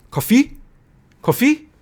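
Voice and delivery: "coffee" as co-FEE, second syllable high; misplaced stress, high questioning "coffee"; wrong stress. Shown as a demonstration. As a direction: ask "coffee?" rising sharply on FEE. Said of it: "my stress is in the wrong place".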